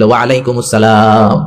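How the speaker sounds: low, slow, lilting greeting back; imitating a gentle woman's musical reply; honeyed, melodious voice. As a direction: return the salaam sweetly, drawing it out. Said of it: "জাদুমাখা কণ্ঠে, মধুমাখা কণ্ঠে, সুরেলা কণ্ঠে".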